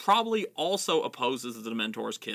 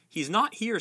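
a touch of anger, pitched up; angrily; 8 semitones higher than usual. Acted. Mrs Weasley turning Fudge away, mildly angry. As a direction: snap back a little heated. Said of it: "said Missus Weasley angrily".

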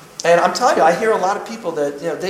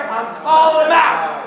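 higher, slow, shouted call-out; real shout; 8 semitones higher, 4 dB louder, slower than usual. Real, not acted. Preacher calling out Joel Osteen to the church, shouting. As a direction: hammer it out like a public challenge.

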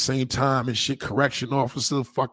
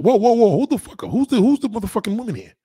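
higher, louder mock outrage from the woman; hammed-up woman's protest, high and loud; indignant woman; 9 semitones higher, 7 dB louder than usual. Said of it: "And she's like".

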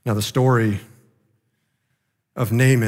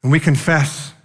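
earnest prayer; 4 dB louder than usual. Acted. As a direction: pray to the Father, earnest and steady. Leads closing prayer.

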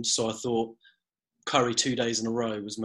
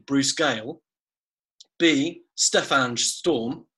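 ordinary talk

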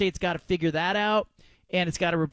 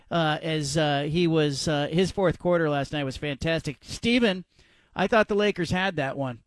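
ordinary delivery

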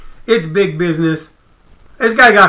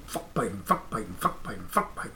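much lower, much softer, near-whispered curse; seething mutter; 11 semitones lower, 20 dB quieter than usual. Retold or imitated. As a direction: grumble it under your breath over and over. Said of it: "Putin gets up every day going".

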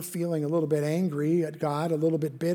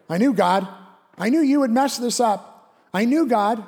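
higher, louder whining at God; mock exasperation; 5 semitones higher, 5 dB louder than usual. Believer grumbling at God, louder and higher. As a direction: complain like someone proved right. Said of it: "and you're like".

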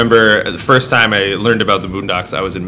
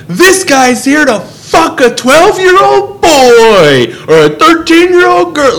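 yelling; 5 dB louder, slower than usual. Retold or imitated. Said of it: "yelling it in the store".

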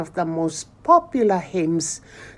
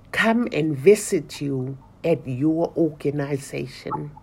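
ordinary delivery

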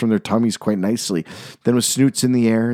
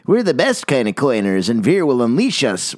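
smug, higher boast; bragging; 4 semitones higher than usual. A narrator's reading. Snooty coiners bragging to the rest, smug. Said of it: "would brag".

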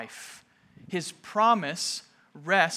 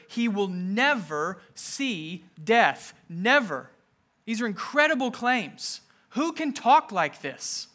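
higher and louder, pressing each claim home; preaching at full force; 4 semitones higher, 4 dB louder than usual. Acted. Pastor to congregation on life and death, fervent preaching. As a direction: proclaim it like a pulpit climax, rising and insistent.

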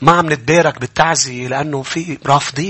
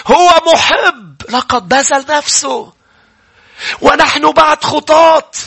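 full-force preaching; 12 semitones higher, 5 dB louder than usual. Acted. Preacher proclaiming God's love from the cross, full-force preaching. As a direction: declare it to the back row, stretching the key words.

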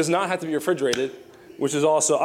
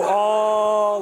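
held, steady open vowel; sustained mock "aah"; long drawn-out "aah"; 6 semitones higher, 4 dB louder than usual. Shown as a demonstration. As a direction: draw the sound out long, wide open. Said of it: "like that".

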